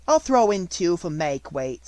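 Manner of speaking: hearty baker woman. A narrator's reading.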